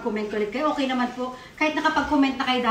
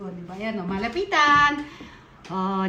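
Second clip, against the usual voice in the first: ordinary delivery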